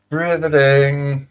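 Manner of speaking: "Riveting" stretched long on a high held pitch; high, slow, sustained "Riveting"; drawn-out riveting. Acted. Host repeating one word for effect, drawn out high.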